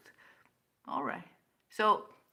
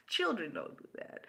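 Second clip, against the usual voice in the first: whispered repeat; 2 semitones higher, 6 dB quieter than usual. Real, not acted. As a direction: breathe it out as a smug aside. Mocking repeat.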